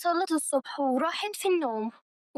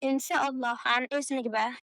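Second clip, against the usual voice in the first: softly subdued, a touch of sadness; mildly sad, polite and soft; 3 semitones lower, 5 dB quieter than usual. A narrator's reading. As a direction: say it quietly, understated sorrow. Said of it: "بحزن واضح على وشها".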